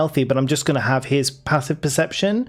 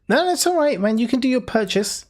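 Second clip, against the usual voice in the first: much higher, friendly merchant voice; high NPC voice; 10 semitones higher than usual. Acted. Shopkeeper telling the player to finish shopping, high and friendly. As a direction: play a chirpy shopkeeper.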